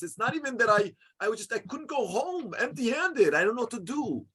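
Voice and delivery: high, pained, near tears; bitter pleading. Retold or imitated. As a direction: pour out shame and desperation. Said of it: "this man cried bitterly".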